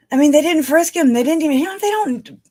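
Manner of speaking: loud, high-pitched outrage; indignant. Real, not acted. Woman protesting police failure after uncle's murder, indignant. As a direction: burst out in exasperated disbelief.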